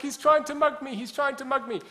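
high, loud, panicked shriek; hysterical landlady. Retold or imitated. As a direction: shriek it like she's being chased. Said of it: "And she's like".

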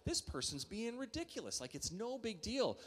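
high, scoffing hammed-up disbelief; mock exasperation. A pastor retelling his scoffing at the driver, high. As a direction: wave off the driver's fuss.

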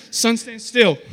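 loud, commanding call to the heavens; mock command. Preacher racing the clock, mock-commanding shout. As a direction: order it with playful grandeur.

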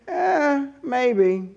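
doubtful shrug of a voice; skeptical eh. Retold or imitated. Peter doubting the news at the tomb, unconvinced. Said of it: "He's just thinking".

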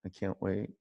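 soft longing repeat; quiet repeat. Real, not acted. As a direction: say it again under your breath. Repeated, dropping to near whisper.

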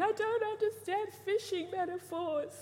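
lightly voiced, high mock-sob line; a hint of tearful whining; movie character crying. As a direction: whine it like the film character. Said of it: "he's crying and saying".

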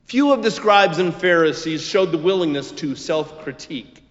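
ordinary talk, nothing marked

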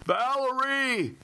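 long, high, slow bellow. Acted. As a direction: bellow her name in alarm. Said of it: "Brett bellowed".

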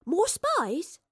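put-on high-pitched young girl's voice; curious young girl. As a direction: pipe up high as a nosy girl. Young girl guesses eagerly.

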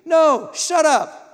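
loud, high, slow shout; drawn-out, shouted put-down; emphatic rebuke. Acted. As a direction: cut off the excuse forcefully. Preacher rebukes the excuse.

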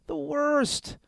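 high, falling wail; hammed-up misery; miserable whine. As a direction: moan it in despair. Laments upsetting his friend.

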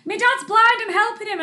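high, loud mimicked protest; imitated shouting. Cyclist's relative defending him to walkers, imitated shout. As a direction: mimic a frantic bystander pitched right up. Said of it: "she shouted that her dad was blind".

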